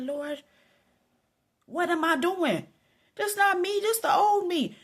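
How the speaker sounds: high, loud, retold appeal to the Lord; exasperated plea. Storyteller crying out to the Lord mid-argument, exasperated plea. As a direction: plead with yourself, pitch climbing and volume rising. Self-rebuking plea to God.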